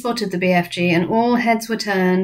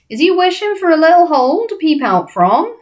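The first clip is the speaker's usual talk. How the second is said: high, loud giant lilt; rising, swooping giant question; friendly giant, obliging; 10 semitones higher, 6 dB louder than usual. Acted. BFG offering Sophie a peephole, friendly giant voice.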